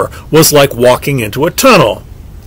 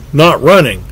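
usual speaking voice

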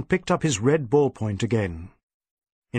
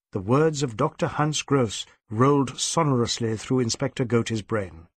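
plain reading voice

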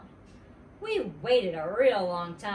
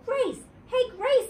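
high, loud call across the room; hollering; 7 dB louder, slower than usual. Acted. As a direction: yell for your friend. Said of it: "I hollered".

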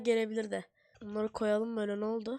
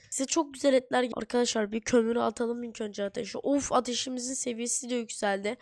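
ordinary delivery

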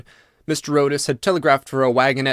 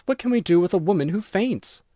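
high, exasperated question; cried out; 7 semitones higher than usual. A narrator's reading. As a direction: throw up your hands in disbelief. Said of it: "cried Missus Otis".

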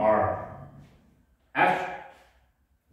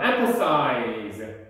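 ordinary talk

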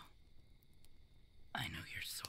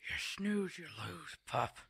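loud, high teasing jab; playful taunt; 10 semitones higher, 7 dB louder, slower than usual. Acted. Wolf teasing the pup who missed the prey, loud and playful. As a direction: crow it at the slow pup.

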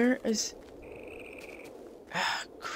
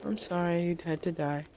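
normal talking voice

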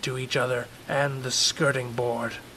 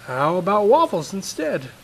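higher hopeful offer; coaxing father; 7 semitones higher, 8 dB louder than usual. Acted. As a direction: try another offer gently.

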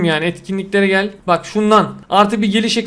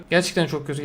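much softer and lower, sinking into disappointment; deflated mutter; 5 semitones lower, 10 dB quieter, faster than usual. Real, not acted. Streamer repeating it to himself, softly deflated. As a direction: let it die away under your breath. Bad pack pull, repeated.

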